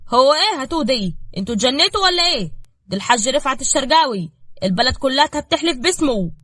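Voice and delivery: loud, high-pitched indignant outburst; angry. A narrator's reading. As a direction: protest furiously at the insult. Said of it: "مندور اتنرفز وقال بغضب".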